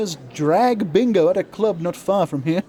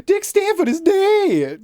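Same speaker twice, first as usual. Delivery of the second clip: high, slow melodramatic pronouncement; milk the death news like a soap opera; overdramatic announcement; 10 semitones higher, 3 dB louder, slower than usual. Acted. Announcer melodramatically reveals the host's death. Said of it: "Why was that the delivery I gave that?".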